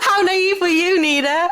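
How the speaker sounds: high-pitched mock incredulity; tease, nearly crying with laughter; laughing tease. Real, not acted. Doctor teasing Nina's naivety, laughing.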